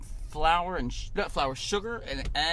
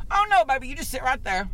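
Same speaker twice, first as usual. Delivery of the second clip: high, bright mock Southern auntie, louder at first; sweet Southern lady; 9 semitones higher, 5 dB louder than usual. Drive-thru worker tells the driver to stay put, doting and high. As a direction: play the doting lady calling everyone baby. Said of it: "she went".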